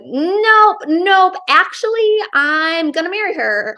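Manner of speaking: high-pitched, emphatic mock objection; hammed-up refusal. Vampire overrules the others to marry her, mock emphatic refusal. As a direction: cut in with playful, high-pitched defiance.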